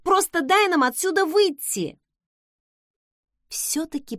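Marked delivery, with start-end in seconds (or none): 0.0-2.0 high, urgent exclamation; exclaimed demand. A narrator's reading. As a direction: pitch jumps up in protest. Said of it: "воскликнула я".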